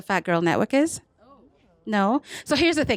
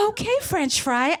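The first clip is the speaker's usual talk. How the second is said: slow, high, teasing drawl; sassy reply; 7 semitones higher, slower than usual. Retold or imitated. Teasing her French match by text, slow high sass. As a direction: stretch it out, amused and flirty. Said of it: "I was like".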